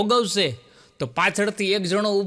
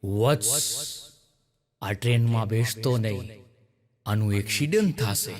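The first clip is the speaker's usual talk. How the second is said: voice from the sky; 10 semitones lower, 4 dB quieter than usual. Acted. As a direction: boom down like a divine oracle from above. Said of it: "અને આકાશવાણી થઈ".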